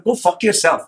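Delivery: normal talking voice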